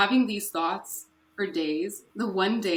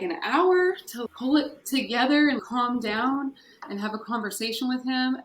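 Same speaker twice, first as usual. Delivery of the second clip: usual speaking voice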